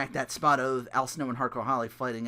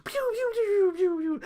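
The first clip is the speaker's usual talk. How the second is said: pew noises; slower than usual. Retold or imitated. Imitating roman candle shots.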